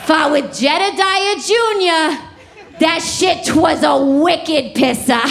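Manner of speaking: put-on thick Boston accent, slow and punchy on pisser. Comic ending the witch bit in a Boston accent. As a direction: lean into Boston slang with a mild mock yell. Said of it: "Boston accent".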